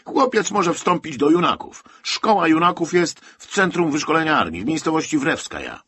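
loud, high military proclamation; irate colonel laying down the rules; shouting officer. A narrator's reading. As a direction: bark out the orders at full voice. Colonel goes on shouting orders.

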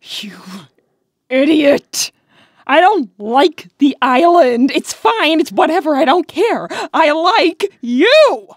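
loud exasperated outburst, voice up high; frustrated shout. Acted. Rouge shouting her confession at clueless Knuckles, loud and exasperated. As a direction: yell it at him, fed up and blurting out feelings.

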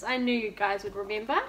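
plain reading voice

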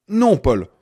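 a flat, cutting no; firm refusal. A narrator's reading. Annie cutting off Paul's plea, flatly. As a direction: shut him down in two words.

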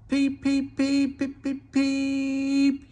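high loud engine whistle peeps; whistling peeps. A narrator's reading. Henry whistling in fear before the tunnel, high loud peeps. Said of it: "he whistled".

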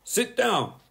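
high, firm schoolteacher voice; a strict teacher scolding a pupil; stern teacher. A narrator's reading. Teacher warning Bertie to sit down, stern and high. Miss Boot's voice.

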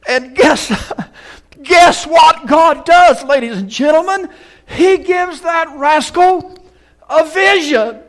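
high, loud, drawn-out preacher's delivery; preaching at full force. Acted. Preacher telling Jacob's story, preaching at full force. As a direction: thunder the story to the congregation.

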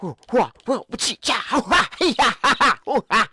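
wild string of martial-arts style yelps; crazy axe cries. Pretend. Player swings the axe at mobs, crazy axe cries. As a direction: let out goofy battle cries while swinging. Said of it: "I went crazy with my axe".

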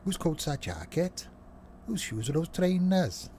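a loose, half-hearted Welsh lilt; sort of Welsh accent. Pretend. Streamer mimics Welsh announcements, sort of Welsh accent. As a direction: lilt it like a shaky Welsh impression. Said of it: "Sort of Welsh".

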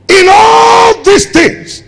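high, loud, slow drawn-out refrain; preaching shout. Real, not acted. Preacher repeating the verse to the church, slow ringing shout. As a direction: hold each word long and ring it out.

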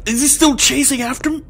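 breathless panic while fleeing; being chased. Real, not acted.

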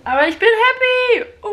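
high joyful squeal; excited. Real, not acted. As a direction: squeal it out with joy. Joyful squeal about being happy.

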